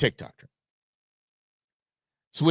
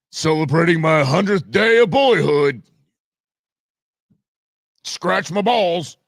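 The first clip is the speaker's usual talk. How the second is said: a hammed-up bro voice, louder; mock dude voice; 4 semitones higher, 6 dB louder than usual. Host mocks a girl acting like a boy, in a put-on bro voice. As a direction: play a swaggering guy, mocking.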